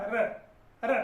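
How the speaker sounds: र as a bare retroflex r-vowel, no ee; repeated correct sound. Shown as a demonstration. Teacher repeats the correct र for students, bare retroflex r. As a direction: say र twice, tongue curled back, no vowel added. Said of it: "मैं फिर से करके बता रहा हूं".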